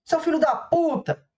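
high, drawn-out shouted insult; imitating a furious shout; mock yell. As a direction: yell it like the angry driver.

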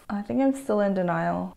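ordinary delivery

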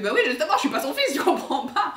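fast, loud, laughing bafflement; laughing confusion. Retold or imitated. Koulouf to the soldier, fast and laughing. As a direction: blurt it out through laughter.

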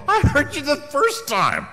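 high, loud, slow drawn-out reply; mock irritation, hammed up; loud hammed-up exasperation; exasperated retort. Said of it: "I go".

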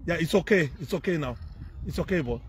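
soft, soothing voice; reassuring. Real, not acted. Comforting a boy who has been crying, reassuring.

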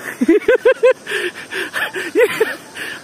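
high, half-crying frightened laugh; nervous laugh-cry. Real, not acted. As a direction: laugh and whimper in real fear. Laughing in fear on the bridge.

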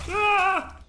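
sudden high yelp; startled shriek. Real, not acted. Gamer jolted by a scare, high startled yelp. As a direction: yelp in fright.

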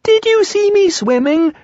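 high squeaky little voice, slow and thrilled; excited squeak. A narrator's reading. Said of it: "squeaked Roo excitedly".

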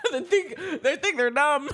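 giggling, high pitched; laughing through the words. Real, not acted. Laughing at numb lips.

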